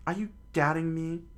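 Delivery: high, loud, fast indignant challenge; indignant kid. Acted. Luffy bristles at Shanks' doubt, high loud indignant.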